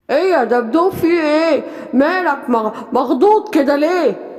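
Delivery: a big friend asking what's wrong; concerned animal friend. Acted. Friend asks startled bear.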